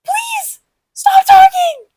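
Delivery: high, shrieked mock plea; pleading shout. Embarrassed kid begging friends to stop talking, pleading shout. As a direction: beg them shrilly to stop, building to a shout.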